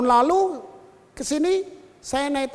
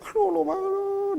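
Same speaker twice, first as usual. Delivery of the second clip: mock crying; 8 semitones higher than usual. Old friend breaking down before the preacher, mock crying. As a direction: imitate someone wailing in tears. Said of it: "nangis terus pergi".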